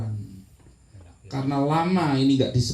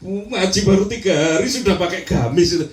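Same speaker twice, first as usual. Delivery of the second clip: sneering neighbour teasing a new worshipper; mock gossip about a neighbour in a gamis. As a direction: poke fun at the gamis-wearer with a laugh. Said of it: "Nggak boleh gitu".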